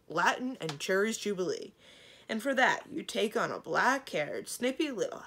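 loud, indignant dragon disdain; scornful. A narrator's reading. Warog deriding Kazul's new princess, scornful. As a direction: sputter scornfully until cut off.

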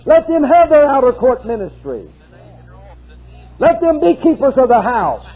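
high, ringing preacher's cry; fervent revival preaching; preaching at full force. Acted. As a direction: preach it out, lifting the congregation.

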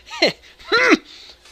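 high, loud silly laugh; goofy laugh. Acted. Laughs at own silly joke.

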